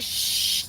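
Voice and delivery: sharp unvoiced shush; imitated shushing. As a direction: cut off the singer with a hush. Imitates Matt hushing her again.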